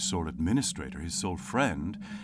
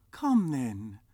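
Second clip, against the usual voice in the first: light, higher cheerful reply; gaily; 7 semitones higher, 3 dB louder than usual. Acted. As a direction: answer with a smile. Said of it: "returned the nephew gaily".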